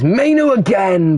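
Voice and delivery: a jump up in pitch and volume on the chance; sudden excitement. Real, not acted. Commentator calling a shot that goes wide, sudden excited lift. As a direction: spike the voice as he shoots.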